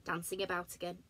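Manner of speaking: ordinary delivery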